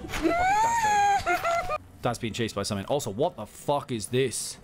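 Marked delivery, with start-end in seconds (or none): 0.1-1.8 anguished yell. Real, not acted. Friend chased, yelling.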